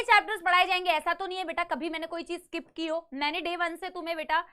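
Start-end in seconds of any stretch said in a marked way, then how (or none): none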